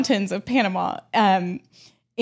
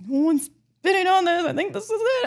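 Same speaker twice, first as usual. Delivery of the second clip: high worried voice; 9 semitones higher than usual. Retold or imitated. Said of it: "I'm like".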